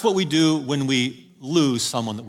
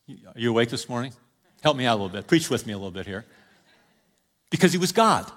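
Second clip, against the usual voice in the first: ordinary talk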